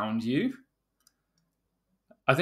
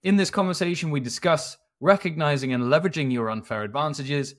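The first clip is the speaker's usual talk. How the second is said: crisp scripted host introduction, lifted at each new point; podcast intro read; 3 semitones higher than usual. Acted. Host welcomes listeners and introduces the guest, polished podcast intro read. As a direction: read out the show's intro to listeners, slowing into the sign-off.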